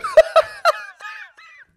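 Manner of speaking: high screaming burst of laughter; shrieking laugh. Real, not acted. Cracking up retelling Jared's insult, shrieking laugh. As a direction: crack up completely.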